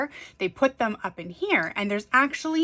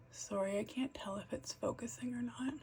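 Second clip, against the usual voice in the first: whispering; 2 semitones higher, 15 dB quieter than usual. Real, not acted. Aside while adjusting camera.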